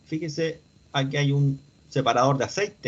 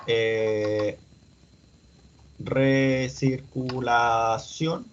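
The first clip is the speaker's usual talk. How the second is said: very slow, syllable by syllable; absent-minded, drawn-out monotone while searching; 3 semitones lower, much slower than usual. Real, not acted. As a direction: stretch each syllable as if typing it. Typing a search term while speaking.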